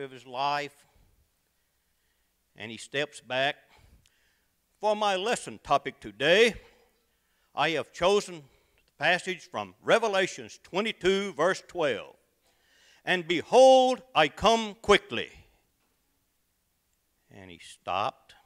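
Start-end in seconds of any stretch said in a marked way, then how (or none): 4.8-12.2 preacher opening. Acted. Young preacher opening his sermon. 13.0-15.3 proclaiming the verse. Acted. Nervous preacher proclaiming the verse.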